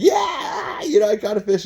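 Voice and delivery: sudden high whoop of joy; excited yell. Retold or imitated. Fisherman whooping over his catch, loud and high. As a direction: holler like you just landed a fish. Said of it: "you gotta stop getting so excited".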